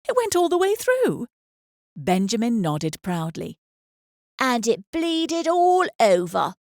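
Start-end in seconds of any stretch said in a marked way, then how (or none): 0.1-1.3 put-on much higher, louder proud little boy; excited boy. A narrator's reading. Boy boasts to his mother, excited child voice. 4.4-6.5 put-on slow, louder, higher childish boast; proud boy. A narrator's reading. Son tells his mother proudly, childish boast.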